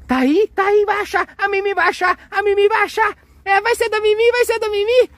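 high-pitched singsong egging-on; excited cheering. Pretend. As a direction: cheer the little searcher on in a high, sustained pitch. Cheering the child's search on. (torcida animada, voz bem aguda)

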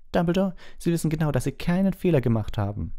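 agitated, high-pitched protest; McGonagall. A narrator's reading. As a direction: object heatedly, high and urgent. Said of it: "sagte Professor McGonagall aufgebracht". (aufgebracht, mit hoher Stimme)

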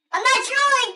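high, loud denial; frantic protest. Pretend. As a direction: protest loudly that you mean it. Denies trolling as players doubt them.

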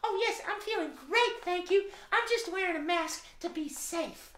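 high, cheerful goose puppet voice; a sweet friendly goose greeting the kids; high bright puppet goose; Sis Goose voice. Acted. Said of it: "Sis Goose, are you feeling okay?".

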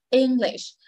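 English with the first syllable prominent; stress on first syllable. Shown as a demonstration. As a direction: lift ENG and let lish drop in English. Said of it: "Cái từ E nhấn trọng âm".

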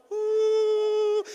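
loud, high, sustained "woo"; imitated whistle call. Farmer summoning men from everywhere, imitated whistle call. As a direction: mimic a piercing summoning whistle, held long. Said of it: "he blew this sound out of his mouth".

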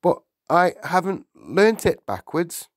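high, hesitant schoolboy protest; nervous pupil pleading with the teacher; flustered, halting, rising at the end; stammering. A narrator's reading. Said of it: "stammered Wilfred".

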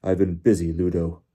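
loud, curt character voice; tight-lipped, jerky reply; Crouch, jerky. A narrator's reading. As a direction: speak jerkily, barely moving the lips. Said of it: "still talking in the same jerky fashion".

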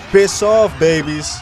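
frustrated gamer yelling at swarming babies; angry shout. Real, not acted. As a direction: snap at the babies, full force. Swatting enemy babies.

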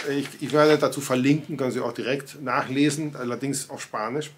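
ordinary talk

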